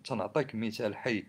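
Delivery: ordinary talk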